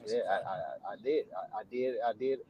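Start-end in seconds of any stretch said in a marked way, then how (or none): none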